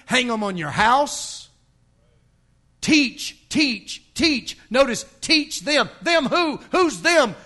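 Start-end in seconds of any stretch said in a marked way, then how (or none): 2.8-7.4 "teach" punched high, each one set apart; hammered emphasis. Real, not acted. Preacher quizzing the congregation on who to teach, "teach" hammered high. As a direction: drive "teach" home in separate high bursts.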